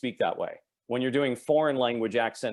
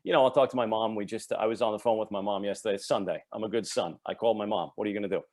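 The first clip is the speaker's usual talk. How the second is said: working-class New York accent, Long Island style. Shown as a demonstration. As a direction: talk like a Long Island native on the phone with Mom. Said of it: "this is what I sounded like".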